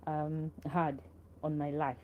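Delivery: ordinary talk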